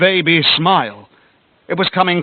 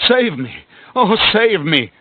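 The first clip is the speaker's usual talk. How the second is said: desperate plea, higher; cried; 6 semitones higher, 3 dB louder, slower than usual. A narrator's reading. Victor begging his friend, frightened cry. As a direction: beg for rescue in terror. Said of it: "I cried".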